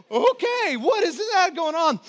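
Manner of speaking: high, breathless questioning; flustered panic. Retold or imitated. Pastor reliving the swim, high rattled panic. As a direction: sound rattled and losing control.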